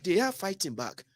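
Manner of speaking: "are" said softly, with the line falling away; quiet repeat. Shown as a demonstration. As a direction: drop the voice for the repeat. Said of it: "So they are fighting back".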